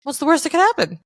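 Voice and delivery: high, breezy mock bravado; carefree self-talk. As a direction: toss it off like nothing can go wrong.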